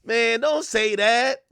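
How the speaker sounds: high, slow, hesitant mock protest; hesitate, then draw out a high, meek plea; imitated timid objection. Rappers meekly answering [name]'s violation, mock timid objection. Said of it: "they're like".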